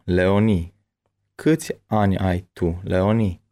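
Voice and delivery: ordinary talk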